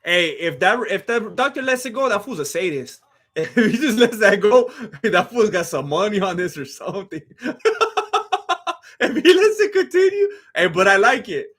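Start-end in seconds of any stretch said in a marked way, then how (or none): 3.3-7.2 high, laughing through the words. Real, not acted. Laughing at the doctor letting a bloody fight go on. 7.4-8.7 high laugh. Real, not acted. Laughing hard at the cut. 9.0-10.3 high, laughing through the words. Real, not acted. Laughing at the doctor letting a bloody fight go on.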